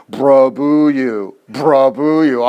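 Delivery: "Brabuyu" said slowly, each syllable clear; careful "brabuyu" imitating the app; computer pronunciation. Shown as a demonstration. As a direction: sound out "brabuyu" like the program. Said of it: "tells you how to say it".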